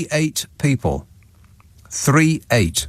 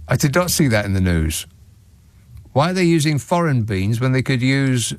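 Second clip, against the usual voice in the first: normal talking voice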